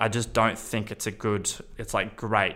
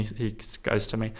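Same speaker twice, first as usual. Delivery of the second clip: ordinary talk, nothing marked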